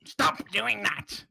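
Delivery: kobold refusing the whispered spell, high creature voice; high, drawn-out squawk of protest; angry kobold. Acted. Kobold resisting words whispered into its ear, indignant creature voice. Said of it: "it goes".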